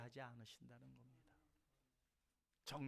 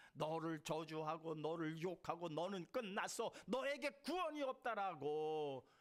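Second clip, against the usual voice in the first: mock accusers; 4 semitones higher, 4 dB louder than usual. Voicing curses of accusers.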